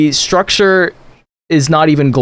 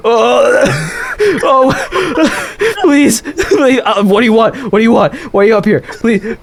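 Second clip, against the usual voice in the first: frightened pleading; 7 semitones higher than usual. Acted. Frightened NPC begs.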